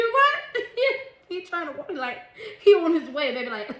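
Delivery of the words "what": high loud laugh into "What?"; loud real laugh. Real, not acted. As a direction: crack up laughing. Laughing at her own impression.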